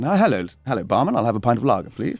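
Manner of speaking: a hint of plummy, proper English; posh English accent. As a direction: play the drama-school toff ordering a pint. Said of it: "speaking really well, kind of nicely like this".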